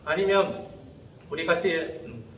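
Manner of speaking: low, halting, tentative asking-out; acted awkward, softened invitation full of pauses; hesitant invitation. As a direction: fumble through asking your crush out, with fillers. Acting shy invitation to a crush.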